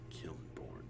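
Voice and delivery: whispering. Acted. Overseer names her in whisper.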